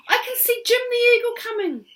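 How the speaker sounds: put-on very high, loud girl; excited girl. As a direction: point it out eagerly. Rose voiced.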